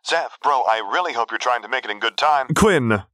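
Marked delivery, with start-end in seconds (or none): 2.5-3.0 raised, higher-pitched call. A narrator's reading. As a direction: call his name over the wind. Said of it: "he called out over the wind".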